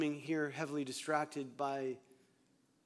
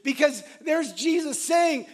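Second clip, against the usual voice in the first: excited shout; 9 semitones higher, 9 dB louder than usual. Real, not acted. Preacher building to shout.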